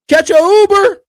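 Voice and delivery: ordinary talk, nothing marked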